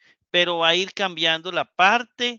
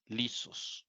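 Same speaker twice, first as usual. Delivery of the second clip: whispered word; 4 semitones lower, 13 dB quieter than usual. Shown as a demonstration. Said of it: "teacher repeating Greek word".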